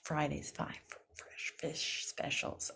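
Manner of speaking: whispered quick run through; fast tongue twister. Shown as a demonstration. Coach saying the twister fast for practice. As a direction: rattle it off under your breath. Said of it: "Say that fast".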